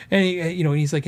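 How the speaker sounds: ordinary delivery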